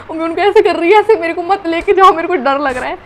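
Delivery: scared pleading. Retold or imitated. Girl begs the nurse not to take her, scared. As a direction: beg them not to take you.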